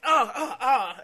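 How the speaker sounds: high, loud hammed-up yelps of pain; mock pained cries. Imitating the kicked wrestler's pained yelps, high and loud. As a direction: yelp like a wrestler selling a kick. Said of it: "it was just like".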